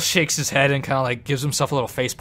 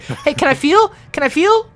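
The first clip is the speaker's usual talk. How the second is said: high, loud begging gnome; pestering, high-pitched gnome request; eager gnome; 13 semitones higher, 6 dB louder than usual. Acted. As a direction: plead like a curious little gnome. Gnome begs to touch teeth.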